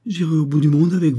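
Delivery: joyful vow. A narrator's reading. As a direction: pledge yourself with sudden fervour. Wife joyfully vows to follow him.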